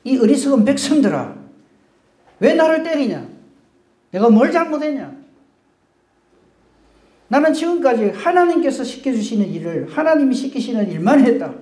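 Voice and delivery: loud, high, indignant scolding; mock furious rebuke. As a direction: berate the crowd who beat him. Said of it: "같이 욕하거나".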